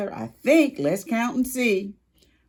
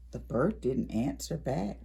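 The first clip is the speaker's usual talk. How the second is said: plain reading voice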